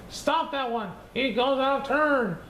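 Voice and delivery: storyteller playing women yelling at a boy cutting in line, high and angry. A narrator's reading. Village women yelling at Conley for skipping, high angry cry. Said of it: "The women began yelling angrily".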